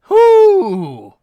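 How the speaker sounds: high startled yelp; startled whoo. Real, not acted. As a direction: jump and shout whoo. Startled by pet again.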